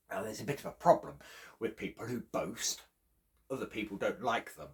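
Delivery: plain reading voice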